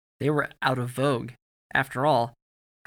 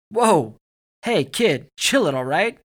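loud, high, startled appeal; raised-voice warning; 6 semitones higher, 9 dB louder, slower than usual. A narrator's reading. Soldier telling boy with a rock to back off, calling out. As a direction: call out to halt someone, high and loud.

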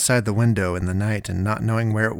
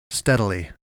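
ordinary delivery

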